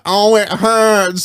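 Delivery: slow, hammed-up whiny complaint; mock whimper. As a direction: draw it out like a sulking kid. Cable-tied kid complaining, retold.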